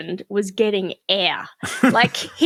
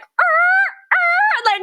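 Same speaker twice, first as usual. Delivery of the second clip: imitated screaming; 6 dB louder than usual. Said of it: "we were like".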